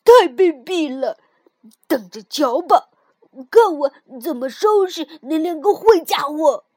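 put-on an angry child vowing revenge; furious. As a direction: spit out the indignation. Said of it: "跳跳听了非常气愤".

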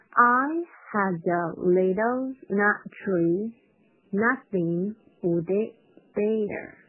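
very slow, word-by-word reading; drawn-out, careful model line; slowed-down demo. Shown as a demonstration. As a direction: stretch each word out for learners. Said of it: "我同样是先快再慢这块".

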